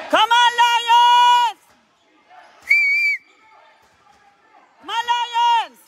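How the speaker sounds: high, loud, long drawn-out fan yell; cheering shout. Real, not acted. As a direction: holler it across the court, stretching the vowels. Cheering team at game. (grito de ánimo alargado)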